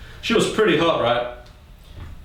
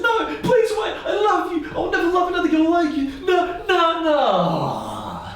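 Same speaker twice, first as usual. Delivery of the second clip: desperate pleading. Acted. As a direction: beg her not to walk away. Begging girl not to leave.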